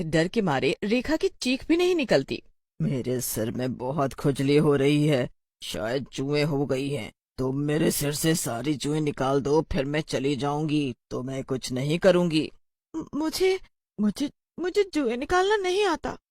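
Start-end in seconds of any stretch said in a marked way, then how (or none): 2.8-12.5 low-pitched eerie witch voice; creepy witch. Acted. The witch asks Rekha to pick her lice, low and creepy. 13.0-16.2 high, stammering timid voice; frightened girl. Acted. Rekha tells the witch she can't pick lice, frightened.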